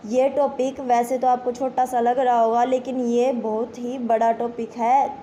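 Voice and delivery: normal talking voice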